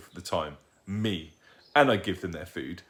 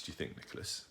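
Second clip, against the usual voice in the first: whispered aside; 3 semitones lower, 15 dB quieter, faster than usual. Acted. Dad's aside to Nicholas.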